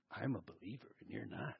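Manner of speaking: lightly mock a smug believer. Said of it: "have this holier-than-thou attitude".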